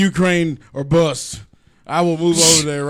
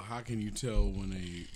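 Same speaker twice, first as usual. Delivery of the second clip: very soft, slow and low; hesitant, barely projected delivery; off-mic mumble; 8 semitones lower, 15 dB quieter than usual. Real, not acted. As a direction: mumble the joke setup away from the mic. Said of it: "speaking to the mic".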